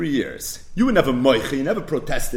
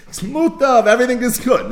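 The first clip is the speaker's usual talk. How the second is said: higher, louder, all sugary friendliness; mock sweet smile; 8 semitones higher, 5 dB louder than usual. Lender smiling at the borrower, hammed-up friendliness. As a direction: sell it like a nice guy with a grin. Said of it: "Mutav now already smiling as a shayna mensch".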